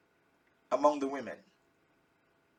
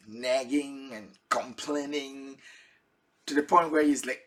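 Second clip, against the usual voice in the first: ordinary talk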